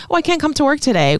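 high, quick mocking whine of an excuse; high, rushed mimicry of the caretaker calling out. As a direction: toss off the flaky excuse in a high, fast mock voice. Mocking caretaker's flaky text.

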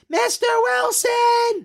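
high, drawn-out mock kid holler; slow, very high-pitched bratty call; Dennis the Menace. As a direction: holler for the neighbor like a pesky cartoon kid. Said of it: "is this kid Dennis the Menace?".

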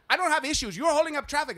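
high, loud defensive outburst; shouted, high-pitched mock retort; imitated yelling. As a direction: mimic an irate attendant yelling back. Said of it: "like, yelling".

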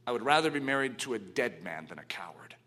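unvoiced whisper, fierce and low; a wife whispering her challenge to her husband; whispered retort. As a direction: hiss it close to his ear. Sabina's famous retort, whispered.

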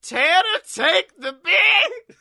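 high, loud, insistent demand; loud high yell. Acted. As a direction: shout it up high and slow. Forcing bees on him.

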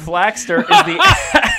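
high laughing squeal. Real, not acted. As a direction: break into laughter mid-word.

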